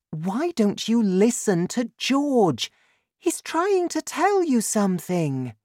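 high-pitched female character voice; wife urging husband. A narrator's reading. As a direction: plead the boy's case to your husband.

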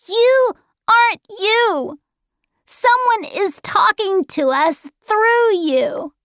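put-on high, emphatic child's accusation; accusing boy. Charles Wallace exposing the man's controller, high accusing boy. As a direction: challenge him in a high voice. Boy challenges the possessed man.

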